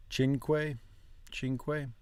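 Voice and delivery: "cinque" said CHEEN-kway, softly. Shown as a demonstration. Learner checks Italian example, soft KW sound. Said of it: "more of a qua".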